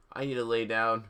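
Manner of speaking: usual speaking voice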